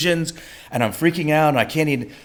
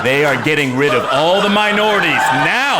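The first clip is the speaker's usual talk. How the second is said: mock ominous aside; 5 semitones higher, 6 dB louder than usual. Joking panic on the plane.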